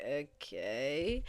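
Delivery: soft, unimpressed questioning okay; flat Bella. Retold or imitated. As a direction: say it warily. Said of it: "she's like".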